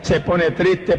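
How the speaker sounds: ordinary talk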